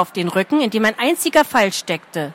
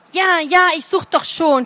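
grumbling; 7 semitones higher than usual. A narrator's reading. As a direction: snap back, annoyed. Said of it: "schimpfte Lien".

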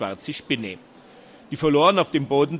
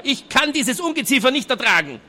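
much higher, louder voice of revulsion; disgusted; 11 semitones higher, 5 dB louder than usual. A narrator's reading. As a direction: squeal out his loathing. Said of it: "voll Abscheu".

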